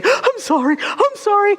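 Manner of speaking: high, teary mock apology; mock apology through tears. A crying student apologizing in class, mock teary. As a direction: imitate someone crying and apologizing. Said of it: "immediately we say".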